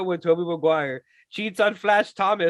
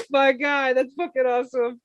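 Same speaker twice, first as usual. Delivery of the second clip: laughing; 7 semitones higher, 3 dB louder than usual. Retold or imitated. Retold own laughing reaction.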